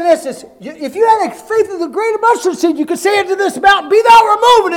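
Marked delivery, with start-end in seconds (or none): 2.8-4.7 rising to a high, loud command; preaching shout. Acted. Preacher commanding the mountain to move, a high loud shout. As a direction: thunder it at the mountain.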